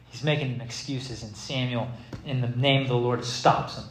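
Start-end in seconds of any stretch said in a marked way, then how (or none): none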